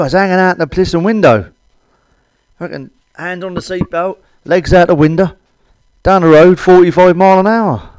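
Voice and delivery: ordinary talk, nothing marked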